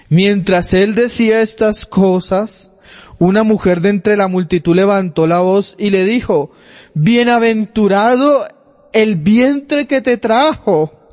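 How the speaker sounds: high, loud and slow preaching read; preacher reading the Bible aloud with fervour; fervent scripture reading. Acted. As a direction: proclaim the verse to the congregation. Reads gospel passage with force.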